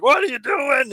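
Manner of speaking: high, loud outraged shout; screaming fan. Retold or imitated. Fans outside the press box yelling at the team, screaming. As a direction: yell it like an angry fan in the stands. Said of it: "are screaming".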